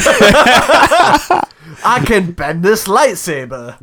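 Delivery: high, loud, drawn-out fake British accent; bad British accent. Mock Beckham boasting about his lightsaber, bad British accent. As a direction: ham up a terrible British accent, slowly. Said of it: "really bad at British accents".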